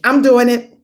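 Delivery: loud, high, determined shout; fired-up yes to the dare; eager declaration. Retold or imitated. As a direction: announce it like nothing can stop you. Repeated eager declaration, louder.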